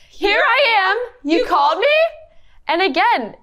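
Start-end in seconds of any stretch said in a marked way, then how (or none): 0.1-2.0 put-on high, slowed childlike voice; eager little boy answering; Samuel's line.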